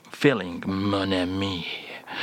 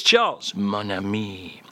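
normal talking voice

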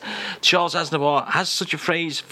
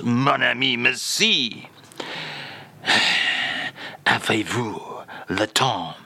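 hammed-up French accent, lightly lower; mock French accent; 3 semitones lower, slower than usual. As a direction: play the French crooner in a comic French accent. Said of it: "read us out today's French phrase".

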